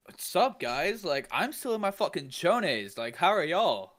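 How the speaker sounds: high, loud cheery hello; mock cheery greeting. Friend replays his groggy cheery greeting, high. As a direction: greet them like nothing's wrong.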